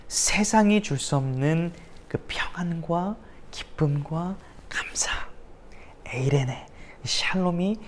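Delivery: slow breathy sermon whisper on peace; hushed whisper. Acted. Preacher hushing the congregation, whispering slowly about peace.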